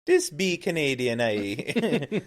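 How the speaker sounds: high, loud fake Canadian, nearly Irish; Irish-sounding Canadian accent. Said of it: "now you're talking Irish".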